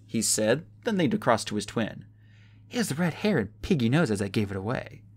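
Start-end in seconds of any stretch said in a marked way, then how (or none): none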